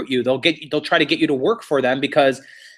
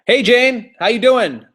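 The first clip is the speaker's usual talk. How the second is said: higher, louder breezy hello; cheery greeting; 8 semitones higher, 4 dB louder than usual. Retold or imitated. Larry back from vacation greets Jane, higher and louder. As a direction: sing out a carefree hello to the office.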